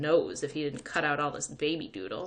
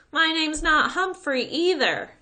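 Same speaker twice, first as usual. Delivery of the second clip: higher, louder, slow taunt; boy teasing from the sidewalk; taunting call; 11 semitones higher, 7 dB louder, slower than usual. A narrator's reading. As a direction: shout it back teasingly. Taunting from bike.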